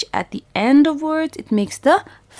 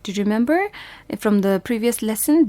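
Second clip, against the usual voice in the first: ordinary talk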